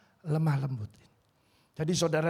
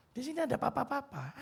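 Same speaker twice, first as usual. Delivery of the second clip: pleading prayer, high; 8 semitones higher, 5 dB quieter than usual. Shown as a demonstration. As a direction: di sini ada papa-papa raised high and pleading. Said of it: "saya berdoa". (doa memohon dengan suara tinggi)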